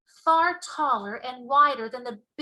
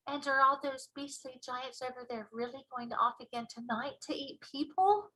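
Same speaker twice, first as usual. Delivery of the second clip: put-on a little girl's softer, hushed question; lightly voiced young girl, softer and wondering; Sophie voice; 9 dB quieter than usual. A narrator's reading. A small girl asks the giant, in a softer voice.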